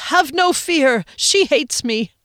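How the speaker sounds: loud, high cheerful proclamation; gleeful boast. A narrator's reading. Phoenix cheerfully reassures Rickenbacker, gleeful and loud. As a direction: announce it proudly.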